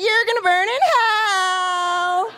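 high, slow gleeful shout; excited damnation. Shown as a demonstration. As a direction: cheer the curse like good news. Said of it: "say that a little more excited".

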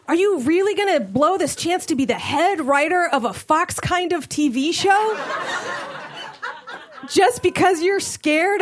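high, pumped-up self pep talk. Retold or imitated. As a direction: hype yourself up.

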